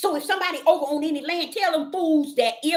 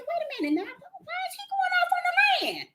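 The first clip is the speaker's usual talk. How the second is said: high, bewildered question; retelling her own high, startled question to the Father; puzzled self; 4 dB quieter than usual. Retold or imitated. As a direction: ask it high and baffled, like someone caught off guard. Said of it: "I'm like, saying".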